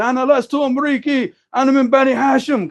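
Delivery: high-pitched shouted reply; yelling back. Retold or imitated. Wounded brother shouting back at the Houthi, high-pitched yell. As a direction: fire the answer back up the hill. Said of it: "And he was yelling back".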